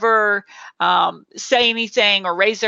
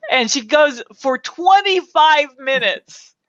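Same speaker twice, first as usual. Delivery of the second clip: ordinary talk, nothing marked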